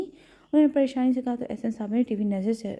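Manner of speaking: plain reading voice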